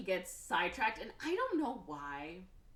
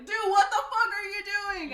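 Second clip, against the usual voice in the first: panicked yell; 12 dB louder than usual. Retold or imitated. Timo being beaten up.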